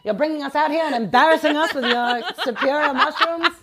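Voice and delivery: put-on old Hollywood mid-Atlantic accent, giggling through it. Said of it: "In your mid-Atlantic accent".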